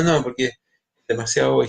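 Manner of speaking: normal talking voice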